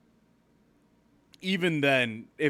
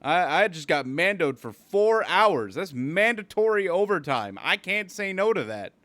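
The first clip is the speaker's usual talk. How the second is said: louder, higher exasperated rant; mock annoyed rant; 8 semitones higher, 8 dB louder than usual. Worker replaying an annoyed rant at an old lady. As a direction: blow off the lady, hammed-up annoyed. Own retort voiced, loud.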